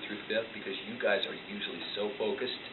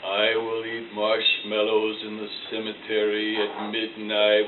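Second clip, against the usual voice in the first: slow, hushed ghost-story voice; spooky voice; 3 semitones lower, 3 dB louder, slower than usual. Acted. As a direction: drag it out like a haunted tale. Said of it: "in a spooky voice".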